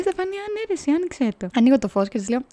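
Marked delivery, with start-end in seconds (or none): 0.0-1.5 friend's reply, voiced much higher; squeaky, put-on voice for the half-asleep friend; a hint of a sleepy roommate; imitating friend. Friend answers from bed at night.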